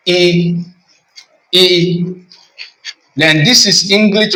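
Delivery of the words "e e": E said as a long clear "ee"; demo vowel sound. Shown as a demonstration. Teacher modelling the E vowel, a long clear "ee". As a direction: model the vowel E slowly as "ee" for learners. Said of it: "Sounds as".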